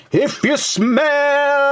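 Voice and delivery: high, drawn-out wrestling promo call; The Rock catchphrase. Retold or imitated. Quoting The Rock's catchphrase to his viewers, drawn out. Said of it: "The great one says".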